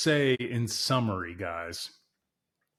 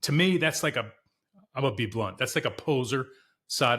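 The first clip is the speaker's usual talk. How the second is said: normal talking voice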